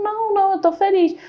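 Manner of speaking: a mãe responde com voz bem mais aguda, fingindo calma; mãe chorosa. Retold or imitated. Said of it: "Ela falou". (mãe chorando diz ao filho que está feliz, voz bem aguda)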